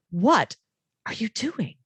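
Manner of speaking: emphatic What, set apart from the rest; stress on What. Shown as a demonstration. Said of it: "on the first word and said".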